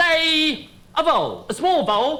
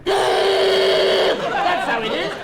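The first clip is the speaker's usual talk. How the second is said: death cry; 5 semitones higher, much slower than usual. Pretend. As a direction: wail it out slowly like a dying ant. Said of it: "the death cry of a worker ant".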